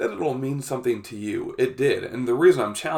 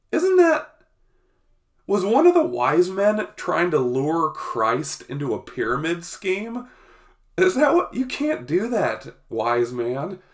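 high, incredulous scolding; mock outrage; 6 semitones higher, 3 dB louder than usual. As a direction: act shocked and indignant. Mock outrage at wise man.

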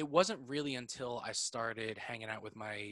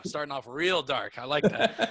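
laughing; 3 semitones higher, 8 dB louder, slower than usual. Real, not acted. Laughing at dark joke.

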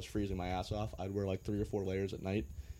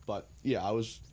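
normal talking voice

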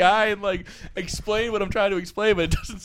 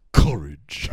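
very low, put-on cowardly-lion voice; deep cartoonish lion impression; Wizard of Oz lion. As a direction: imitate the lion, dropping right down in pitch. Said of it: "That's the line".

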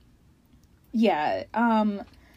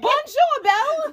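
cheesy French greeting; 14 semitones higher than usual. Pretend.

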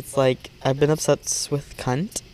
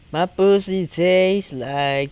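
slow drawl; 4 semitones higher, much slower than usual. Pretend. Jokey drawn-out line.

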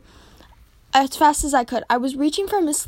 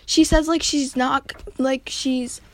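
plain reading voice

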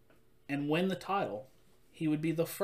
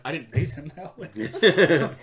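dad voice; 7 dB louder, slower than usual. Retold or imitated. Dad answers the wrestlers.

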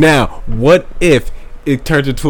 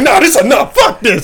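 mock fed-up protest; 8 semitones higher than usual. Comic acting out a man quitting the fight, mock fed-up. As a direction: call it off in pretend alarm.

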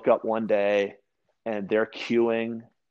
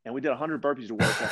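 usual speaking voice

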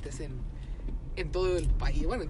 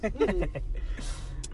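laughing; 3 dB quieter than usual. Real, not acted. Laughs at friend's teasing.

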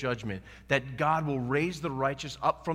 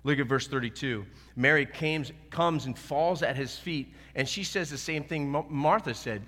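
ordinary delivery

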